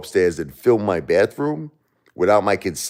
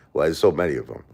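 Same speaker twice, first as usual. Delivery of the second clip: ordinary delivery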